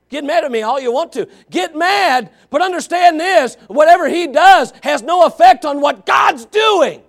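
rising to a full shout on God's doing; preaching shout. Real, not acted. Preacher shouting down the doubters in his congregation.

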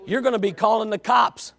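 ordinary talk

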